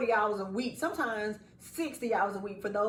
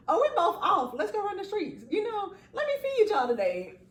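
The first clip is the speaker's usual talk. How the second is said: high excited invite; 10 semitones higher than usual. Retold or imitated. Inviting her pregnant friend out, giddy and high. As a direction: pitch it up like a giddy offer.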